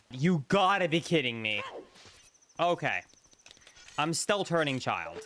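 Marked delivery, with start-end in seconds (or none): none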